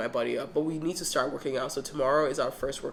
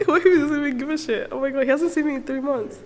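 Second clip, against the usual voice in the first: high, fast words through laughter; giggling nervously about the reunion; nervous laughing; 9 semitones higher, 6 dB louder than usual. Real, not acted. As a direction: laugh out the worry. Nervous about the dog's reaction.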